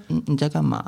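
put-on soft cutesy baby voice, barely above a murmur. Shown as a demonstration. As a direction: coo it softly like talking to a boyfriend. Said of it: "来，娃娃音讲几句话。".

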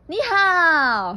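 high, loud, drawn-out hello; cheerful loud greeting. Acted. Bear blithely greeting the angry little fox, loud greeting. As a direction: belt out a big hello.

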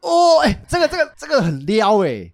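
high, loud excited reaction; thrilled gasp of delight; excited squeal. Real, not acted. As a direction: squeal at the juicy detail.

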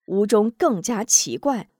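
usual speaking voice

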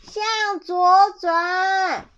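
high, very drawn-out holler; loud shout. A narrator's reading. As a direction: owl bellowing each syllable slowly. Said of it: "猫头鹰大喊".

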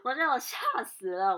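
吓死了 said loud and high; startled. Real, not acted. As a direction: burst out still shocked.

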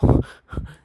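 a sudden loud burst of laughter; loud breathy laugh. Real, not acted. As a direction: crack up loudly. Laughing after swearing.

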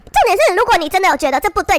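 ordinary talk

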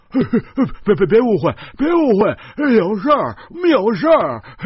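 high, loud frantic pleading; panicked shout. A narrator's reading. Dragged to the ground, shouting frantically at his attacker. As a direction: yell to stop him before he hits. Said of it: "他大声叫".

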